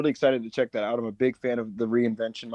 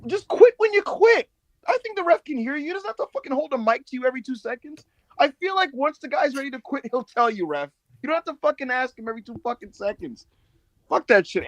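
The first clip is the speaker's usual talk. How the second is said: exasperated rant; 11 semitones higher than usual. Real, not acted. Ranting at the ref.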